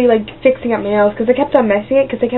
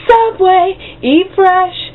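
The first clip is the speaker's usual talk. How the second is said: higher, bright jingle-style slogan; 7 semitones higher, much slower than usual. Retold or imitated. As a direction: deliver it like a TV ad tag. Quoting the slogan.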